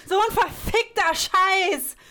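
slow, drawn-out furious curse with a laugh first; cursing on request. Acted. Client curses on the coach's invitation, slow furious cursing. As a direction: let the swearing rip, long and slow. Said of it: "Ja, richtig doll".

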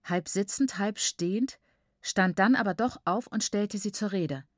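ordinary delivery, nothing marked

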